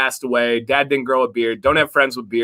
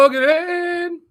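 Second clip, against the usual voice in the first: much higher mock Irish "Hoagland"; Irish accent. Host repeats the name in a mock Irish accent. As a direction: sing "Hoagland" like a stage Irishman. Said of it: "the Ireland accent of how you say".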